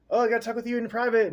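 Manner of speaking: high, fast, eager Kemusha; excited Kemusha. Retold or imitated. Kemusha pulls the Pharaoh aside, high and rushed. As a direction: blurt it out high and quick as an eager friend. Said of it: "Kemusha was like".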